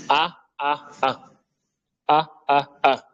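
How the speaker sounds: A said open-mouthed and clear, repeated; vowel A demo. Shown as a demonstration. Teacher models the fatha sound for the class. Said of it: "Comme vous voyez sur la première image".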